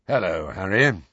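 low, grim greeting; understated grimness. A narrator's reading. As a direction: say it grimly, pitched a little down. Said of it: "he said grimly".

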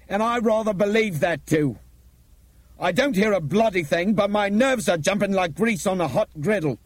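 high, loud, driving speech; intense, vibrating with anger and alarm; angry urgency. A narrator's reading. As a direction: rouse everyone with forceful urgency. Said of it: "His voice vibrated with anger and urgency".

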